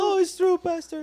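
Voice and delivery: very high mock sobbing wail; imitating crying. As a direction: echo the tearful confession. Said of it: "they start crying". (imitación de llanto, muy aguda)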